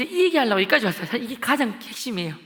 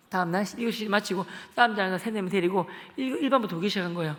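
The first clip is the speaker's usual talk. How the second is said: normal talking voice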